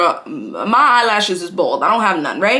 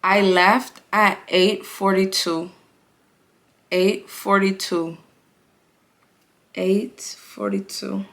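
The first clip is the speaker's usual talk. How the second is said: slow, each repeat quieter; dramatic repeat; 4 dB quieter, slower than usual. Real, not acted. Telling followers how long it took, slow and fading. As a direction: let it sink in, fading. Dramatic repetition of leaving time.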